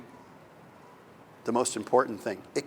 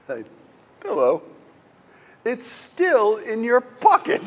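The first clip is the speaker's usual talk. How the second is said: higher, louder, slow mock surprise; 9 semitones higher, 7 dB louder, slower than usual. Gandalf catching Bilbo with the ring still in his pocket, teasing. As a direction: catch him out with a teasing hello.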